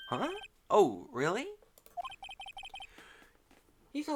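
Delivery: a hint of gossipy cop; lightly voiced officer. Voicing desk officer NPC.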